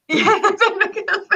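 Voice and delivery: amused laughing reply. Real, not acted. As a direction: chuckle through the line. Laughs at Derek's answer.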